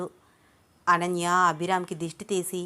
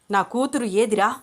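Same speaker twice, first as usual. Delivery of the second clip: louder, heated demand; agitated, angry; 3 semitones higher, 5 dB louder than usual. A narrator's reading. Father demanding his daughter from Abhiram, heated. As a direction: demand an answer angrily. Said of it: "చాలా ఆవేశంగా అడుగుతున్నాడు".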